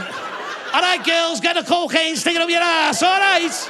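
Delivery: high, loud Bootle gangster shout; mock county lines boss rallying the troops; gang boss. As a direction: holler like a hammed-up gang boss. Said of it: "county lines gang boss in Bootle going".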